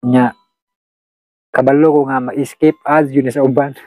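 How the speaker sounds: normal talking voice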